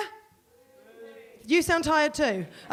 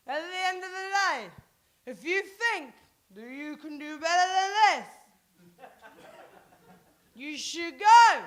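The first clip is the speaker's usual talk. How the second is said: put-on slurred, drawn-out drunken declaration; acted drunk; 4 semitones higher, slower than usual. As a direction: drag the words out like a proud drunk. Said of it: "I got really drunk".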